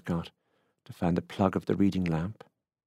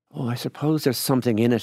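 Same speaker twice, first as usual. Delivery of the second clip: higher-pitched grudging concession; reluctant; 5 semitones higher, 5 dB louder than usual. A narrator's reading. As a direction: concede it unwillingly. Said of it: "she said reluctantly".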